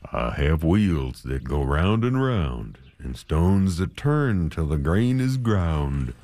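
low, slow, measured miller; lightly voiced miller. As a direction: drone like the turning millstones. Miller answers in rhyme.

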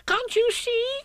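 very high squealed question; tiny animal's frantic cry; shouting Piglet. Acted. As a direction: squeal it in exasperation. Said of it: "shouted Piglet".

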